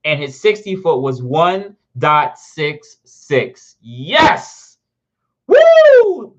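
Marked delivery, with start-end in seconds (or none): 3.8-4.8 loud, triumphant cry; excited yes. Real, not acted. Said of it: "I'm so freaking excited". 5.5-6.3 long, very high, loud woo; excited whoop. Real, not acted. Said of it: "I'm so freaking excited".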